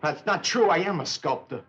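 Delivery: indignant. Acted. Defending himself in a quarrel.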